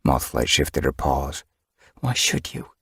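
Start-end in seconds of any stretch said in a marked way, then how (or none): 2.0-2.7 whispered. Acted. Inner thought, unvoiced.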